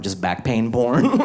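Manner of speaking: ordinary talk, nothing marked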